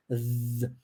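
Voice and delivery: long vibrating z; voiced th buzz. Shown as a demonstration. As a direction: feel the mouth tremble. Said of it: "Repare que o som é vibrante".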